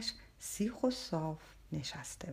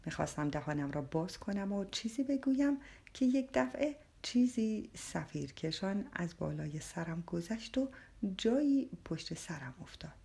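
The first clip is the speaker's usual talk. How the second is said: usual speaking voice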